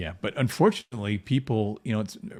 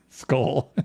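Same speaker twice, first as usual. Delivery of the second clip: laughing; 5 semitones higher, 6 dB louder than usual. Real, not acted. Laughs at own joke.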